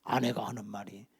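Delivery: normal talking voice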